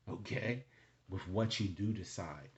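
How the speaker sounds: ordinary talk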